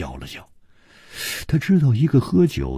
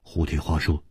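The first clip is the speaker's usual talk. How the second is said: plain reading voice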